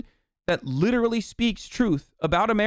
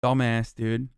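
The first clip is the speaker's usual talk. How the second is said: low disgusted mutter; muttered insult; 6 semitones lower, slower than usual. Real, not acted. Muttered insult after rant.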